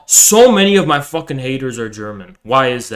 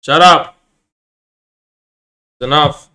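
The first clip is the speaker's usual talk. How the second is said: annoyed shout; 11 semitones higher, 5 dB louder than usual. Real, not acted. Yells to stop the noise.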